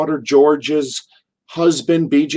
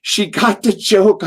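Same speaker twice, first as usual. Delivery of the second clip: plain reading voice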